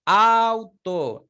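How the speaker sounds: Auto said high and loud, A opened wide; model word. Shown as a demonstration. Teacher modelling open vowel, emphatic. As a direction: push the A in Auto up and out. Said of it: "la A se abre más".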